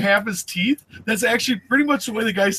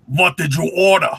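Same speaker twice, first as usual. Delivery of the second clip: put-on slurred, gruff German-accented cook; thick German accent; 3 dB louder than usual. Cook answering a customer at the bar, thick German accent. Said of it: "thick German accent and this slur".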